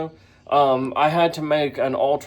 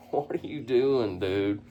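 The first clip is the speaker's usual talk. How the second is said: normal talking voice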